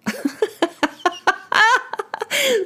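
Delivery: long high cackle of laughter; real laughter, high and helpless; laughing. Real, not acted. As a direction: crack up at how silly it was. Story ending cracks her up.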